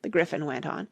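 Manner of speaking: ordinary delivery, nothing marked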